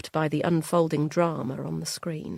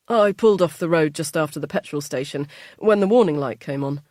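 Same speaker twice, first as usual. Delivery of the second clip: louder, fed-up complaint; irritated grumble; 7 dB louder, faster than usual. Acted. Husband complains about the broken-down car, irritated.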